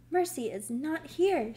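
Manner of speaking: understated alarm; mild alarm. A narrator's reading. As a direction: report it with a touch of panic. Wife alarmed, pet is missing.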